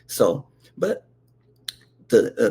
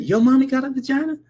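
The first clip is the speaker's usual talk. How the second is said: much higher, fast squeaky disbelief; shocked kid; 11 semitones higher, faster than usual. Retold or imitated. Boy stunned by Timmy's mommy claim, squeaky shock. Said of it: "I said".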